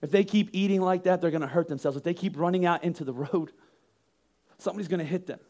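soft, quiet, caring musing; gently worried for them; calm concern. Retold or imitated. Parent stepping back to think, soft calm concern. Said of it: "stepping back going".

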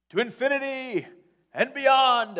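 very loud and high, ringing out; heroic battle cry. Retold or imitated. Buzz leaping from the banister, heroic cry. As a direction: launch it like a hero's call. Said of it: "And he looks and he says".

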